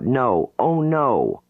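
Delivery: high, frightened cry of no; panicked cry. Acted. Jane under hypnosis, crying out in panic. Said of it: "She cries out".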